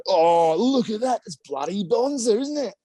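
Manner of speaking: put-on slow, high Australian accent; a proud local in a broad Australian accent. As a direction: lay the Aussie accent on thick, slowly. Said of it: "Really whipped out the accent there".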